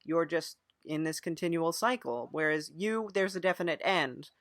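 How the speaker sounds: normal talking voice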